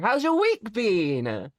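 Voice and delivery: ordinary delivery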